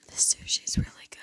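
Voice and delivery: close-mic whisper; giggly prank whisper. Shown as a demonstration. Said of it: "You have to whisper into the mic".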